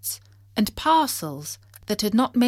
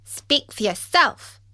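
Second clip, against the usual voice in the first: high, loud odd creature voice; quirky, indignant interjection; peculiar voice; 6 semitones higher, 5 dB louder than usual. A narrator's reading. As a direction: butt in with a strange voice. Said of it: "said an even more peculiar voice".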